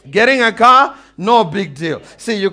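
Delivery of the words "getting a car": rising, louder call to the crowd; preacher lifting the congregation, loud and emphatic. Real, not acted. Preacher to congregation, loud emphatic build.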